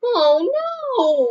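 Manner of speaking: high, loud wail. A narrator's reading. Franklin wailing when the sled gets stuck. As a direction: wail in dismay. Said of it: "wailed Franklin".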